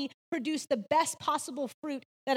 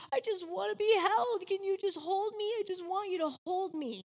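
imitated crying plea; 5 semitones higher than usual. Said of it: "He's crying".

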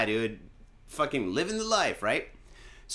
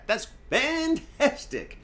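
sarcastic; 12 semitones higher, much slower than usual. Real, not acted. Sarcastic after huge bill.

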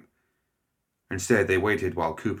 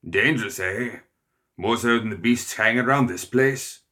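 a hint of a bluff old lord, louder; gruff old hunter; 4 semitones higher, 5 dB louder than usual. A narrator's reading. Cooper scoffing at Ludwig's report, bluff and loud. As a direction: scoff at the danger like a big-game hunter.

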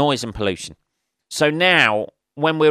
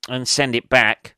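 normal talking voice

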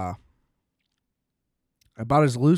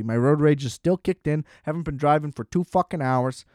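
ordinary talk, nothing marked

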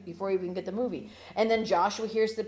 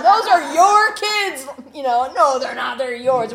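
put-on high, loud, slow "those are your kids"; hammed-up quarrel between two parents; mock parental bicker; 7 semitones higher, 16 dB louder, slower than usual. Parents pawning off children, bickering.

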